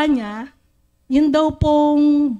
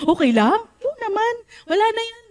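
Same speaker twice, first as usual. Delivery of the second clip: much higher, light and easy; cheerful reassurance; 10 semitones higher than usual. Retold or imitated. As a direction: wave it off happily. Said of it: "Sabi niya ganun". (masiglang pag-alo)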